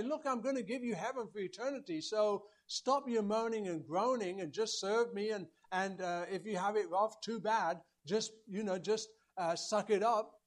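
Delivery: like a boss telling you to stop whining; dismissive mock voice. Preacher voicing an uncaring God, dismissive.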